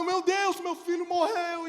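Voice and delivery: high, loud, slow anguished wail; desperate cry. Retold or imitated. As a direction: wail it in despair. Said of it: "E eu chorando".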